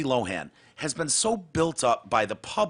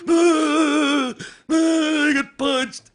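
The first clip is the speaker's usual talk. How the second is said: high, loud hammed-up whimper; mock whimpering; 13 semitones higher, 5 dB louder, much slower than usual. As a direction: whimper like a fake victim. Mocking her crying.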